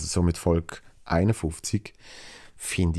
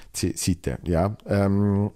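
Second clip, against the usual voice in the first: usual speaking voice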